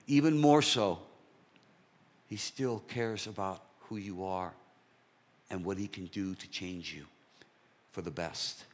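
ordinary talk, nothing marked

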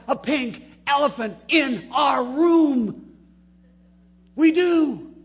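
loud, high preaching shout; slow build to a full-throated cry. Real, not acted. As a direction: hammer the point home to the congregation. Preacher's climax.

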